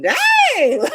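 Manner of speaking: high, loud laughing shout; shocked laugh. Retold or imitated. Reacting to mom's matter-of-fact answer, laughing shout of surprise. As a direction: blurt it out in amazement. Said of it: "I'm like".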